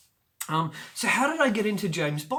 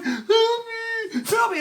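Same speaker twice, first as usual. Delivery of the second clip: very high, loud desperate scream; boy with a dog biting him screaming for help; 8 dB louder, much slower than usual. Retold or imitated. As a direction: shriek for help with a dog on you. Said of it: "I'm screaming out".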